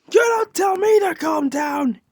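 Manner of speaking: high-pitched indignant shout. Acted. Hothead rejecting a classmate's advice, shouting. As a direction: blow up at being told what to do. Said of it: "please stop shouting at me".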